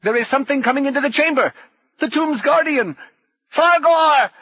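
high, loud, frantic warning; panicked high-pitched yell; alarmed shouting. A narrator's reading. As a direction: shout in alarm as danger closes in. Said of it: "the thief became even more alarmed".

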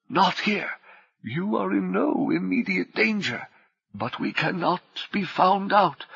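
high, soft, controlled urgent voice; high, soft warning held steady; controlled urgency. Acted. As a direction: keep the crowd steady while warning them. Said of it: "riding the panic, holding it down".

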